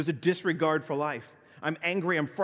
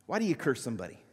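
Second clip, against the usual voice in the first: ordinary talk, nothing marked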